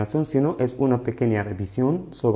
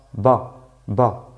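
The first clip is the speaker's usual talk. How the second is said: normal talking voice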